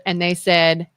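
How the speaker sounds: ordinary delivery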